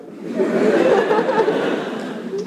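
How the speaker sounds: squealing real laugh; high laugh. Real, not acted. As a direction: burst out laughing. Laughing at own story.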